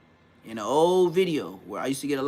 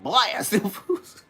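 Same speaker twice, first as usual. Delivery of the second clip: higher, slow questioning echo, breaking into laughter; incredulous echo; 4 semitones higher, much slower than usual. Retold or imitated. Young homie echoing the OG's words, amused disbelief.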